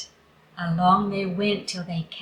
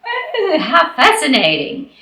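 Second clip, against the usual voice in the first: high, loud swooping oh; delighted rooster; 8 semitones higher, 8 dB louder than usual. Acted. Rooster Wooster hearing the news, loud high delighted gush. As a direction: gush with wonder. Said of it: "he said".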